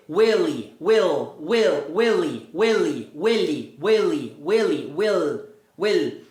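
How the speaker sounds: "Willy" as "uéli", shrinking to "will" as "uél"; same sound with E. Shown as a demonstration. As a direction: drill "Willy" and "will" with E, falling each time. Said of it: "Agora faz o mesmo som com o E". (Willy virando will, com som de E)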